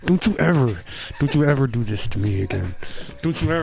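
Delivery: imitating a clenched-teeth warning; through gritted teeth. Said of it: "Talking through his teeth".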